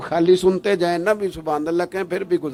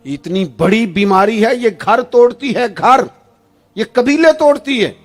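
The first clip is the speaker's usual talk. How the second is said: raised, much higher sermon voice; fiery preaching; 6 semitones higher, 7 dB louder than usual. Acted. Preacher scolding families who lie at weddings, fiery. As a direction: thunder it at the congregation. Preaching against lies at full force.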